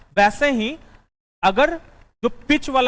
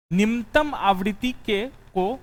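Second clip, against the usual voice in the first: dictating notes. Acted.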